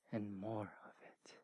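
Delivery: hushed whisper to close the thought. Real, not acted. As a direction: drop to a whisper. Sermon climax, whispered.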